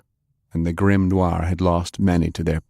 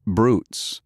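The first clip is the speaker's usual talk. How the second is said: ordinary delivery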